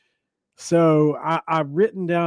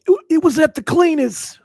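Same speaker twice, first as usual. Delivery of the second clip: put-on New York accent; 14 semitones higher, 6 dB louder than usual. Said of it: "That's my New York accent".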